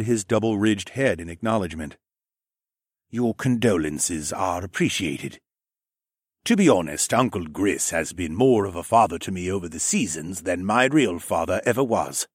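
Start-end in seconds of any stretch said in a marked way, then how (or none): 3.1-12.3 measured, formally voiced lizard man; sombre raptor man. Raptor man speaking of his father's decline, measured and restrained. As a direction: state it with restrained grief.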